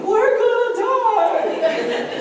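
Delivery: long drawn-out high laughing scream; roller coaster scream. Retold or imitated. Preacher reliving his roller coaster panic, screaming with a laugh. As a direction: shriek it like you're plunging down a drop. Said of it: "I've screamed".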